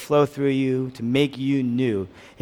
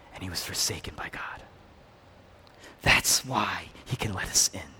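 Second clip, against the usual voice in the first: whispered, unvoiced, lower; 7 semitones lower, 6 dB quieter than usual. Pastor hushing the congregation at the climax, whispering.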